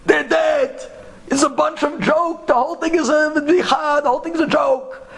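high, heated ranting; retold angry screaming. Taxi driver rants at the scholar about the dead, acted screaming. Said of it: "He starts screaming".

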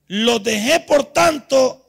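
loud, drawn-out scripture reading; preacher thundering out a verse; declaiming God's verse. Acted. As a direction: proclaim God's judgment with force. Reads God's angry verse aloud.

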